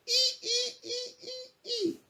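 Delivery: very high, thin monkey squeal; monkey squeaks. A narrator's reading. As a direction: squeak like a teasing little monkey.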